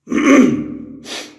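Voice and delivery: sharp loud throat clearing, high and rasping. Real, not acted. Investigator in the cell clears his throat loudly. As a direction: clear the throat hard, suddenly. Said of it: "Sorry about that".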